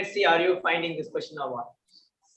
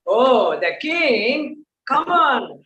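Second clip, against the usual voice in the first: higher, louder hype for the king; host hyping up the king volunteer, higher and louder; excited greeting; 5 semitones higher, 4 dB louder, slower than usual. Real, not acted. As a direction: cheer him on, mock-grand. Host greets self-styled king.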